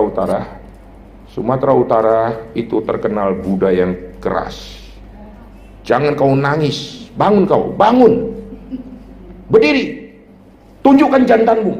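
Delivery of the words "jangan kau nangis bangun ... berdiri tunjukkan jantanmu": harsh Sumatran scolding. Retold or imitated. Preacher imitating a Sumatran elder scolding a crying boy, stern and harsh. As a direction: play the tough Sumatran elder telling a boy off. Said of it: "biasanya orang Sumatera begitu kan".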